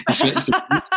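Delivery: laughing through the words. Real, not acted. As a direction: giggle the words out. Laughing while retelling the story.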